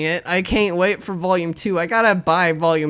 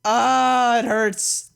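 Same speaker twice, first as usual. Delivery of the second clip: pained wail; 5 semitones higher, much slower than usual. Real, not acted. Player aching at the touching ending, pained wail. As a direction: wail it high, then let it fade away.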